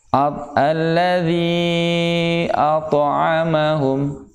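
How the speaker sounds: slow "alladzi at'amahum" with a light joined to; "alladzi at'amahum" read slowly as the correct model; model recitation. Shown as a demonstration. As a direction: recite "at'amahum" drawn out, the to flowing on. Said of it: "jadi bacanya seperti itu".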